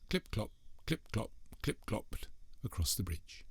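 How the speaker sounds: whispered hoof sounds, soft and breathy; soft clip-clop. A narrator's reading. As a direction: hush it like distant hooves. Hoof sounds told softly.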